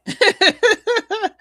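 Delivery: loud, high laugh; high burst of laughter. Real, not acted. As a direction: crack up loudly. Laughing at infomercial joke.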